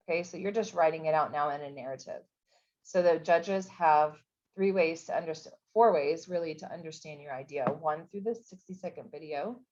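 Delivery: ordinary talk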